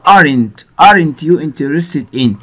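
slow, with the word aren't leaned on hard; stress on aren't. Shown as a demonstration. Teacher stressing aren't for students, slow and deliberate. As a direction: hit aren't clearly as the key word. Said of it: "هنا بقى".

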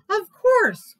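high, loud eager reply; glad. Acted. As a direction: answer with bright certainty. Mole answers gladly.

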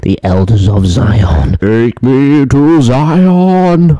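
mock-epic fantasy voice, drawn out very slowly; voz de fantasia épica. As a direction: stretch it out grandly, rising high on the plea. Mimics a fantasy game name.

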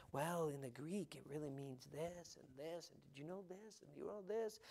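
soft, lightly voiced know-it-all lecturer; mocking a scholar. Preacher mocking preachers who lecture on the Greek, soft and prissy. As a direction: hush it down like a fussy academic showing off. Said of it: "They want somebody to say".

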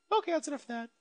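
normal talking voice

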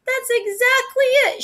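high, bright-eyed exclamation; high excited cry of discovery; excited exclaim. A narrator's reading. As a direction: burst out with delight. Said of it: "she exclaimed".

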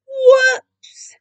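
high, loud whoops; startled cry. A narrator's reading. Narrator as the hat slips, startled. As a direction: yelp it out in sudden alarm.

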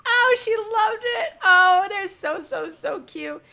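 high, loud and slow; delighted. Real, not acted. Watching the flirt work, high loud delight. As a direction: gush over the couple.

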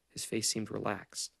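usual speaking voice

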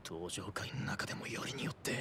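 frightened whisper under the breath. Acted. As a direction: whisper it in fear. Frightened aside about the family.

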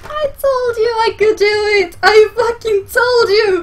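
high, teary, loud insistence; tearful triumph. Real, not acted. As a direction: near tears with joy. Tearful joy after the clear.

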